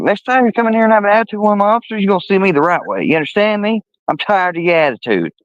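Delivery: high, fast, scolding imitation of the chief; mocking the angry chief. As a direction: rattle it off high and fast like a bossy cop. Angry cop scolding, retold.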